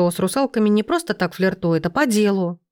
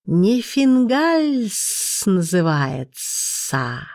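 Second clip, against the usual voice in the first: smug serpent; 2 semitones lower, much slower than usual. A narrator's reading. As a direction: savour each word like a vain snake. Serpent keeps showing off.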